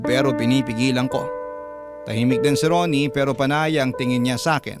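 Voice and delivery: ordinary talk, nothing marked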